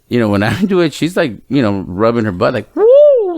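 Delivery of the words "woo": very high, loud yelp; high-pitched pained whoop; pained high woo. Retold or imitated. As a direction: yelp from a stinging smack. Daughter reacting to sting.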